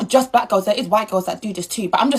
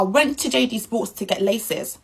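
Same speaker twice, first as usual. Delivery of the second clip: ordinary talk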